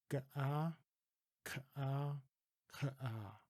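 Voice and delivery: "Ga-ah, ka-ah, ka-ah" with the vowel drawn apart from the first sound; separated Ga-ah ka-ah. Shown as a demonstration. Teacher demonstrates the separated Dutch sound. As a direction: split the first sound off each syllable. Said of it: "I'll separate the first sound".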